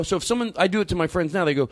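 usual speaking voice

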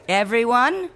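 shrill high screech; screeching teacher. Acted. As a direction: screech it at the class. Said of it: "Missus Kim screeched".

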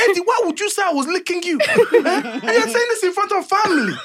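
high, laughing, outraged dad; indignant dad. Retold or imitated. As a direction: son embarrasses dad in front of family, outraged. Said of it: "Dad, remember".

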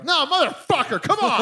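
mock outrage. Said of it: "I go".

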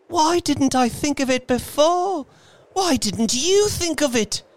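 put-on very high, frantic old woman; shrieky, urgent self-reproach turning on the listener; hysterical. A narrator's reading. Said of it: "she said hysterically".

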